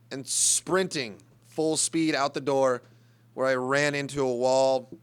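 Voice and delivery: normal talking voice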